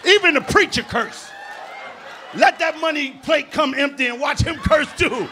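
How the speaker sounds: big laughing punchline; loud preacher jab. Retold or imitated. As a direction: shout it like a roast line. Mocking preacher cursing.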